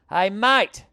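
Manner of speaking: loud, high call across the street. Retold or imitated. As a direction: call out to get his attention. Calling out to dog walker.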